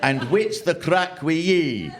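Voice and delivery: slow, broad Ulster Scots; Ulster Scots reading. Retold or imitated. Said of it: "Ulster Scots into English".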